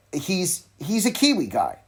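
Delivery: ordinary talk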